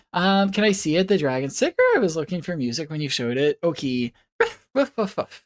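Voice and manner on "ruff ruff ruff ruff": puppy barks. Pretend. Puppy persona barking at chat.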